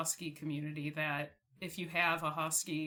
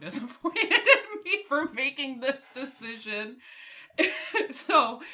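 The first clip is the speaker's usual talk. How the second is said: laughing through the words, higher and louder; 7 semitones higher, 9 dB louder than usual. Retold or imitated. To herself about hiding the dog from her parents, laughing. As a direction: giggle it out while confessing.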